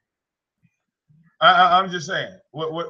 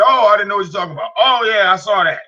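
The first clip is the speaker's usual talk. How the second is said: fast, higher mock voice; mocking retelling; 6 semitones higher, 4 dB louder than usual. Streamer mocks the man's excuse, fast and higher. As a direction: rattle off his excuse in a lightly mocking voice. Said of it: "First he said".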